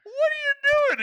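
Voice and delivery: put-on very high, slow shocked old lady. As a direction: squeak it like a startled granny. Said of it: "a old lady's just like".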